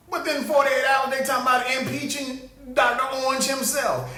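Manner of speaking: usual speaking voice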